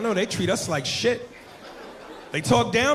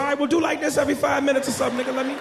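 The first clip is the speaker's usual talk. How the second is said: fast, higher rushed reply; hurried high-pitched compromise; quick retort; 9 semitones higher, faster than usual. Retold or imitated. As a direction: rattle off the deal quickly. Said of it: "I said".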